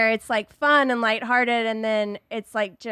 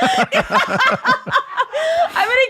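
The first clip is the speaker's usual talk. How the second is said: loud, high, through real laughter; laughing hard; 9 semitones higher, 6 dB louder than usual. Real, not acted. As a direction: crack up while saying it. Cracking up at the other's joke.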